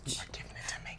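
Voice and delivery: whispering. Real, not acted. Host whispers while she sings.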